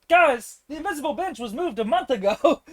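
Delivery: high-pitched acted announcement; skit punchline. Camper breaking the news to the bench sitters, high hammy delivery. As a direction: deliver it high, on the edge of a laugh.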